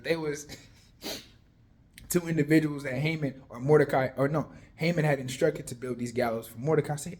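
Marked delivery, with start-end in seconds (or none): none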